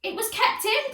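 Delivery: high, loud protest; high, insistent protest. A narrator's reading. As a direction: protest at the call. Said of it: "protested Henry".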